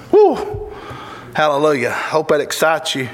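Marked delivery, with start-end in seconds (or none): 0.1-0.7 high joyful whoop; excited whoop. Real, not acted. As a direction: let out a happy holler. Preacher exults after well done.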